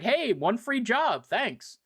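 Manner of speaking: a lightly voiced cocky mercenary; breezy sniper. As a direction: toss off a cocky thank-you. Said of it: "Fang the sniper's like".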